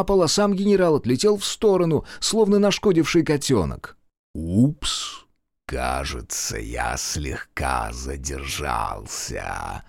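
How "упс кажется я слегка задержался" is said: низкий медленный голос демона, тише; утробно, как демон; утробный голос. Acted. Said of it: "утробным голосом произнёс демон". (утробный голос демона)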